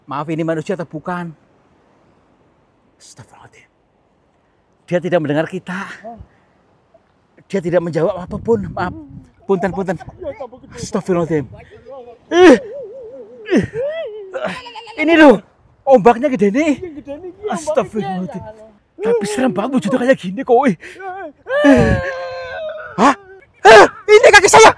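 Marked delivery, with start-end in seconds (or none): 3.0-3.7 breathless whispered astagfirullah; whispered prayer. Real, not acted. Explorer whispering a prayer beside the silent creature. 12.3-16.7 loud, high cry about the big wave; alarmed shout. Real, not acted. Explorer shouting to his friend as a big wave hits, alarmed. 19.0-23.1 quick, higher, rattled "serem banget"; scared exclaim. Real, not acted. Explorer telling his friend how scary the creature looks, rattled.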